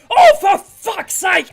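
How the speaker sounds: high, loud outburst of fury; raging scream. Acted. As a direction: yell it like an enraged spectator. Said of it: "as they are screaming, fully raging".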